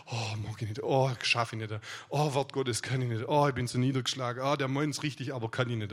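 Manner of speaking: low, moaning "oh" complaints; mock self-pitying soul. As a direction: imitate a defeated soul making excuses. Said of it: "Das ist so dieses Bämulehafte".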